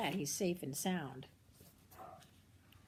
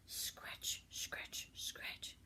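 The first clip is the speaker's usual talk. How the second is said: whispered hoe sound; 7 dB quieter, much slower than usual. Pretend. Hoe noise in the story.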